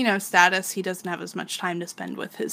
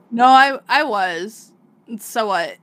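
mock defiant, slow and louder; defiant retort; 3 semitones higher, 9 dB louder than usual. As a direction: brush her off, half-mocking. Guts retorts to Casca.

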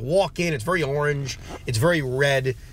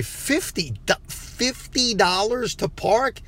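higher, slow, stunned repeat of the price; incredulous; 6 semitones higher, slower than usual. Retold or imitated. Driver to parking lady, stunned disbelief at fifty dollars. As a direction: drag it out in disbelief.

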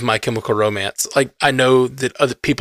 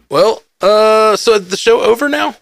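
raised pitch, playful; high mock worry; 8 semitones higher than usual. Real, not acted. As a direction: ask it in a high teasing voice. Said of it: "He's like".

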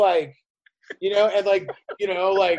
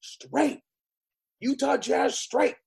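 put-on kid pleading with uncle; 4 semitones higher, slower than usual. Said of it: "I'm just like".

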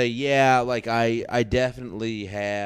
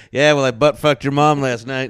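loud, higher-pitched playful retort; mock comeback; 4 semitones higher, 8 dB louder than usual. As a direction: fire back a joke insult loudly. Acts out Chester's comeback.

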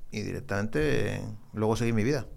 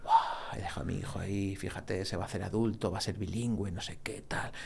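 epic inner soundtrack; 6 dB quieter than usual. Retold or imitated. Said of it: "la banda sonora en mi cabeza de".

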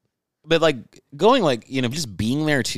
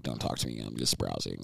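much lower and quieter, a grumpy loner; low, quiet antisocial grumble; low grumbly brush-off; 12 semitones lower, 15 dB quieter than usual. Retold or imitated. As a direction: mumble it low and dark like a surly shopper. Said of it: "I'm just like".

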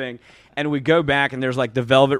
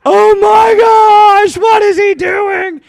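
high, drawn-out horrified shriek, imitating the friends; shocked friends' yell; 13 dB louder, slower than usual. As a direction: yell it in mock horror, way up high. Worried friends react in a retold story.

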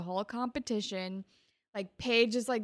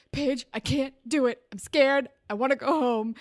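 loud, raised mock-panicky pleading; Spinner freaking out; 4 semitones higher, 7 dB louder than usual. Scared boy begging Paige to let him go home, mock panic. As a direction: whine it in imitated panic, begging to leave. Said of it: "Spinner freaks out and he's like".

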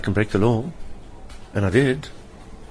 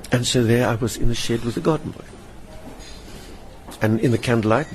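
usual speaking voice

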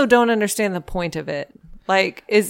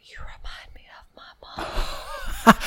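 slow, breathy whisper imitation; 8 dB quieter, slower than usual. Co-host mimicking the contestant on a date, whispering. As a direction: imitate his slow, creepy-soft whisper. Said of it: "like, when he's, like, whispering".

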